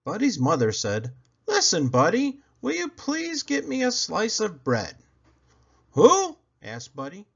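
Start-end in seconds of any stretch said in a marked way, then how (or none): 1.5-5.0 much higher, sweet mother asking; a mom asking nicely. Acted. Mother asking Buddy for bread, high and sweet. 5.9-6.4 high, puzzled little bunny; a small rabbit who misheard; Buddy voice. Acted. Buddy puzzled by his father's request, high voice.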